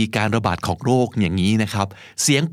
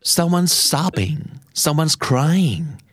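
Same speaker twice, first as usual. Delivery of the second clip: slow model; 3 semitones higher, much slower than usual. Shown as a demonstration.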